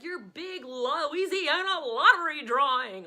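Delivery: slow, loud TV announcer voice. Retold or imitated. Mom imitating the ten o'clock lottery promo, slow and loud, announcer style. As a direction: boom it out like the late news promo. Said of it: "would be like".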